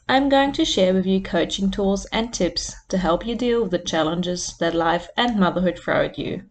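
normal talking voice

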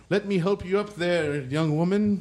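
put-on high, chipper old cyborg; cyborg doorman. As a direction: play the helpful robot doorman. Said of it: "He's like".

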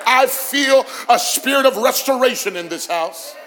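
high, loud preacher's declaration; fervent preaching. Real, not acted. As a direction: proclaim it loudly over the congregation. Preaching at full force.

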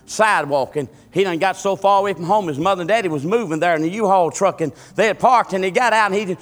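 high, loud revival storytelling; fervent preacher in full flow; preaching at full force. Acted. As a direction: drive the story home to the congregation. Preacher starts new story.

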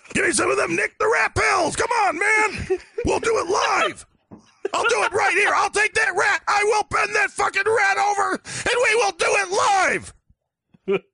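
high, loud hype-man yelling; hyped shouting. Pretend. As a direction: scream it like a crazed pitchman. Hyped shouting pitch for pills.

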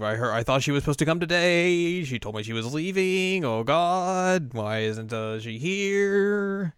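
hammed-up group worry; mock panic. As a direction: stretch the phrase endings in a mild wail. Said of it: "They're all just panicking".

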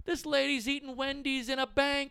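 high, incredulous comic shout; hammed-up, outraged stand-up voice; Sebastian Maniscalco. Comic on stage complaining about a lady in a bank, incredulous impression. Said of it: "you get Sebastian Maniscalco up there going".

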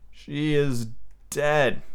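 very slow, hesitant statement; said uncomfortably. A narrator's reading. Peter looking at Wendy's body, uncomfortably. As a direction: drag it out uneasily. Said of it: "he said uncomfortably".

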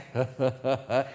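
low, short staccato chuckle; put-on gloating laugh; mock snickering. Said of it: "people that don't like him are like".

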